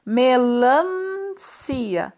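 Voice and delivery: put-on melancia drawn out slowly for the children; teacher models melancia very slowly; slow demo word. Shown as a demonstration. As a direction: stretch melancia so they hear the N. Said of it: "aqui é só falar a palavra".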